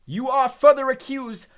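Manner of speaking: loud, high, slow bellow. Acted. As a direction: bellow the charge at the prisoners. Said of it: "bellowed Mister Crouch".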